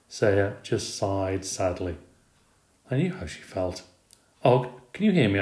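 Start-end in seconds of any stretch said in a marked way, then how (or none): 4.4-5.4 light squeak, slightly higher and louder; lightly voiced hamster calling his neighbour; squeaky hamster call. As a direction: call out to the frog in a little squeak. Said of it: "I squeaked".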